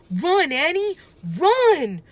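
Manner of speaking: high, loud urgent shout; frightened cry. A narrator's reading. Boy urging his sister to run, a panicked shout. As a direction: yell at her to flee for her life. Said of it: "cried Jack".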